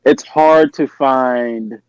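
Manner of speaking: normal talking voice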